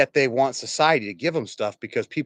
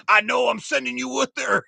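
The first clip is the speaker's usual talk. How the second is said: high-pitched cocky comeback; mock trash-talking retort; 8 semitones higher than usual. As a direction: toss back a boastful taunt. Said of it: "he was trash talking".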